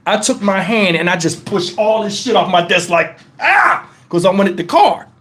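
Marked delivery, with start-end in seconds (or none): 3.4-3.9 short high-pitched "ah!" of frustration; frustrated yell. Retold or imitated. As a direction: re-enact a brief outburst of frustration. Lashes out sweeping the desk.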